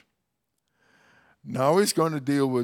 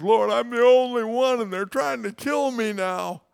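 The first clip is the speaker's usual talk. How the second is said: high, louder whining complaint; whiny self-pity; 9 semitones higher, 6 dB louder than usual. Retold or imitated. Preacher mocks Elijah complaining to God, high self-pitying whine. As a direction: whine it like a sulking child. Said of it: "having a pity party".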